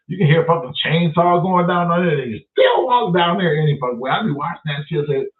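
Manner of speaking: usual speaking voice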